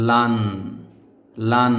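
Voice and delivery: লান said clearly, teacher modelling for repetition; model pronunciation. Shown as a demonstration. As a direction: give লান crisply with a clear final n. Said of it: "লান বলতে হবে". (লান স্পষ্ট উচ্চারণ)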